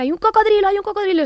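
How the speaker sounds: high, loud imitation of a panicking child; child's night-terror scream. Mother acts out son seeing a crocodile, screaming. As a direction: cry out the alarm like a terrified child. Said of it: "se puso a gritar".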